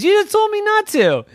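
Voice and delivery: very high, loud, quick squeal; exasperated protest. Acted. Wilt protesting to the ghost, high-pitched indignation. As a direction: squeak out a kid's objection.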